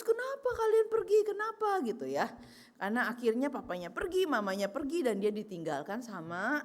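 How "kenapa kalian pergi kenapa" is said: crying plea. Retold or imitated. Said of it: "sambil nangis".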